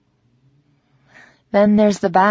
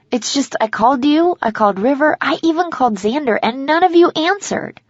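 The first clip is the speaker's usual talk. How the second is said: upset complaint; 6 semitones higher than usual. A narrator's reading. Teary friend tells visitor nobody answered, upset complaint. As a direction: pitch climbing through the list of calls.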